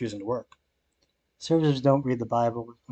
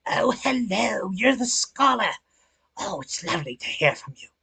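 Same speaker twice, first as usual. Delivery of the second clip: put-on sweet British accent; 8 semitones higher than usual. Impersonating an Egyptian patient's sweet British accent. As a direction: imitate the British lady's sweet welcome in a high British voice. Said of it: "sweet British accent".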